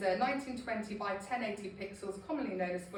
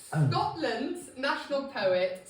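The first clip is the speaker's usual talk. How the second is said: put-on lingering Scottish accent; 4 semitones higher, 7 dB louder, slower than usual. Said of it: "I think I'll stop".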